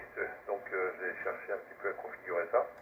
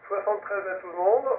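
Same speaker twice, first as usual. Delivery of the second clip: cheerful sign-off; 11 semitones higher, 7 dB louder than usual. Real, not acted. Radio net sign-off to all.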